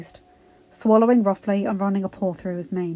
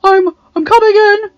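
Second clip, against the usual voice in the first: anxious; 12 semitones higher, 15 dB louder than usual. A narrator's reading. Anxious Adam at her door.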